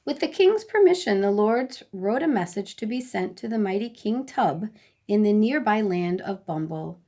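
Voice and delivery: plain reading voice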